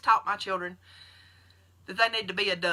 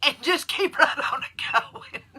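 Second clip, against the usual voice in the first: higher, laughing through the words; 8 semitones higher, 4 dB louder than usual. Real, not acted. Encouraging Becky to keep going, laughing. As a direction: giggle the line out.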